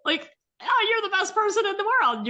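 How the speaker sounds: very high, loud doggy voice; the dog adoring its owner; very high, loud, delighted dog voice; gushing dog voice. Pretend. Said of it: "And your dog is like".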